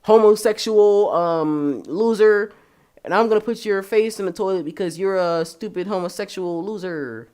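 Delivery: loud, jeering bully voice; hammed-up bully taunting a kid; mock bully. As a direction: sneer it like a schoolyard bully. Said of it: "they're like".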